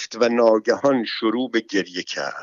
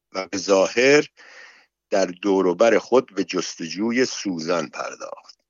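ordinary delivery, nothing marked

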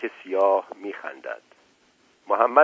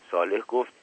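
plain reading voice

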